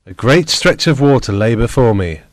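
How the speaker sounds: plain reading voice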